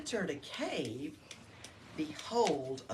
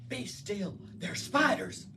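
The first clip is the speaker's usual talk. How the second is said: spat out; 2 semitones higher than usual. Acted. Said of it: "he spat out".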